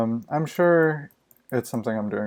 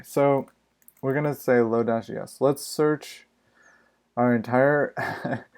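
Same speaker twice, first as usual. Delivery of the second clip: ordinary delivery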